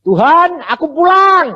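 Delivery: soul announcing it is going home; calling to God. Retold or imitated. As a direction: call out joyfully heavenward.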